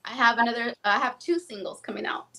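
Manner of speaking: ordinary talk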